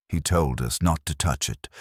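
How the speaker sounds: usual speaking voice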